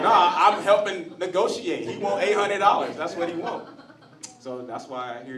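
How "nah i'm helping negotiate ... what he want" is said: defensive, louder and higher pushback; Jose. Retold or imitated. Jose answering his angry friends, raised defensive voice. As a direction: insist it is just negotiating. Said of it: "He was like".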